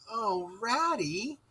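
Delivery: soft, slow despairing cry. A narrator's reading. Mole to Rat, realising there is no food, soft despair. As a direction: sink into hopelessness. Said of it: "he cried in despair".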